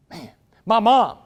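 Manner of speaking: ordinary talk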